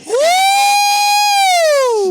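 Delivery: high shocked shriek of laughter. Real, not acted. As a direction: let out a loud, high shriek. Shocked by the party story.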